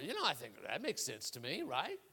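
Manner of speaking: low, soft musing aloud; thinking to oneself. Acted. Turtle weighing the scorpion's argument, thinking to himself. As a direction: drop down as if privately reasoning it through. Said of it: "The turtle thought".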